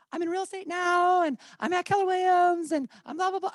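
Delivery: silly voice. Shown as a demonstration. Agent mocking a long self-promoting voicemail, silly high voice. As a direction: ham up a long boring sales pitch. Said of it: "I don't know why I'm doing that voice".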